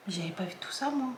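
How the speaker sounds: whispered under her breath, amazed. Real, not acted. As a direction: breathe it out as a discovery. Marvelling at newly spotted details. (chuchoté, émerveillée)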